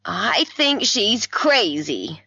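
very upset. A narrator's reading. Mother complaining to the doctor about her daughter, very upset. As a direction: draw it out in distress. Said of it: "very upset".

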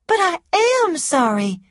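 high, loud pleading woman; insistent protest. A narrator's reading. Scarlett protests to Rhett that she is sorry, insistent.

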